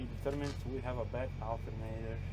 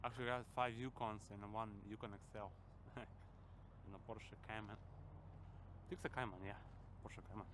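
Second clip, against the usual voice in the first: talking through braces; words slurred by braces; 14 dB quieter than usual. Real, not acted. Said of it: "I'm sorry for my talk. I'm wearing braces".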